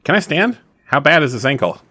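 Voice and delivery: ordinary delivery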